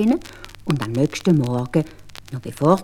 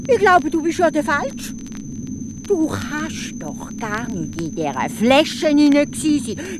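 higher, sly teasing voice; cunning Hans; 11 semitones higher than usual. Acted. Hans goads the spirit, higher and sly. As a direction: coax the giant into the trap.

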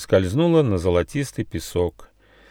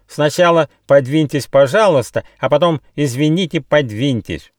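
loud, high mocking complaint; higher, scornful squawking protest; cross parrot; 7 semitones higher, 6 dB louder than usual. A narrator's reading. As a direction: grumble loudly at pushy newcomers. Cross parrot continues his complaint.

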